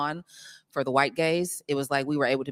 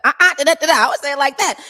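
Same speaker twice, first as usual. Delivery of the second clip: high, fast and loud, trying a line her own way; playful self-demo; 12 semitones higher, 12 dB louder, faster than usual. Retold or imitated. Actor answers director's note with her own reading, playful. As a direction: actor shows the director how she would say it, playful high voice. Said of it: "And I'm like".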